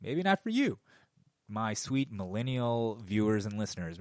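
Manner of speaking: normal talking voice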